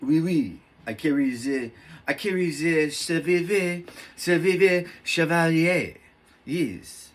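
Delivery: put-on French accent, badly faked. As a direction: play a fancy Frenchman with a thick French accent. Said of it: "that's my best French accent".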